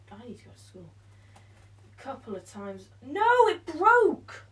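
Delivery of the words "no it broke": high loud wail of dismay; frustrated shout. Real, not acted. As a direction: cry out as it breaks. Band snaps.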